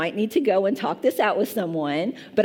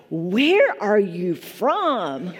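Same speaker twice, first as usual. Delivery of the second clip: slow, drawn-out blurt; mock the man's blunt question; much slower than usual. A churchgoer blurts his question at the preacher, slowly. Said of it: "he blurted out".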